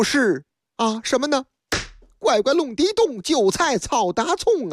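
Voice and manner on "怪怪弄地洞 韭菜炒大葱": a lightly done Nanjing dialect; playful Nanjing dialect tongue-twister. Acted. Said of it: "这是南京话".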